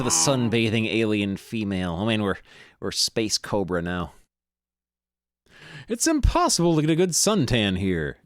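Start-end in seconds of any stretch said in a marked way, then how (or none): 5.9-8.2 griping. Acted. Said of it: "gripes the sunbather".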